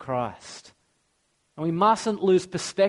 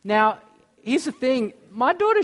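louder and higher, protective warning; emphatic raised voice; 8 semitones higher, 4 dB louder than usual. Real, not acted. Pastor warning the congregation off his daughter, loud and firm. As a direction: lay it down firmly like a protective dad.

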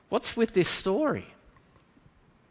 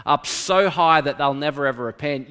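normal talking voice

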